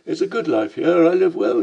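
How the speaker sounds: a cheeky Cockney boasting. Acted. Lamb boasting to the narrator, in a Cockney voice.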